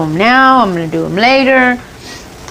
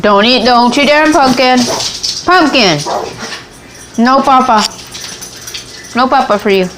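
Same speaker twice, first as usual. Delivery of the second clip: mock scolding; 6 semitones higher than usual. Scolding herself like a child.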